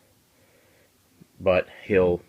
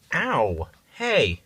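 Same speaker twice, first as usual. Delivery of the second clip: startled yelp; 6 semitones higher, 4 dB louder than usual. Real, not acted. Cat bites or scratches.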